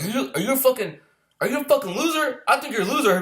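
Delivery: high-pitched needling questions; mock taunting. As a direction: imitate an instructor baiting a recruit. Retold instructors taunting a foreign recruit.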